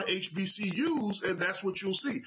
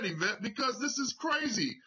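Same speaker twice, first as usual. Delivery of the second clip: usual speaking voice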